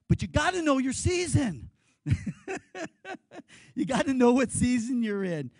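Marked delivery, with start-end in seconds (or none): 2.0-3.7 hearty laugh. Real, not acted. Preacher laughs at own joke.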